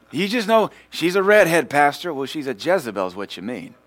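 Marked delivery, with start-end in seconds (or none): 0.1-2.1 high, loud pleading to the pastor, mimicked; mock excuse. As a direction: plead her case in a put-on voice. Mimics congregant excusing a redhead.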